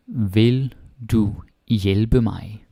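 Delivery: Vil du hjælpe mig stretched out very slowly; very slow question model. Shown as a demonstration. Teacher slowly modelling a question for the class. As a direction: drag out the question for learners.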